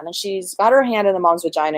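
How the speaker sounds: usual speaking voice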